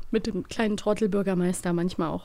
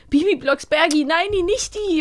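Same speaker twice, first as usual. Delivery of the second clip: higher panicky voice; frightened mayor; 7 semitones higher than usual. Retold or imitated. Podcaster voicing the scared mayor, higher. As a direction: stammer in mock fright.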